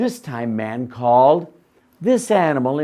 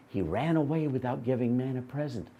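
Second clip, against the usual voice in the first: ordinary delivery, nothing marked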